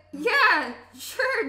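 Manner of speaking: high, loud, laughing reply; flustered agreement. Acted. Character flustered by sweet farewell.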